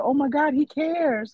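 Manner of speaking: mock swooning mom. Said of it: "He said".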